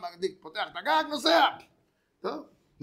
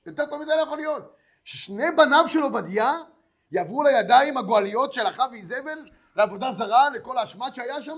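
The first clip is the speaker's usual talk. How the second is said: gleeful; 4 semitones higher, 9 dB louder than usual. Acted. Lender gloating over the two sons.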